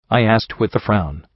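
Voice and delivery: ordinary delivery, nothing marked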